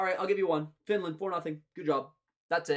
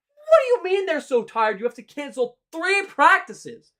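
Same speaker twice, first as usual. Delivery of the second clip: ordinary talk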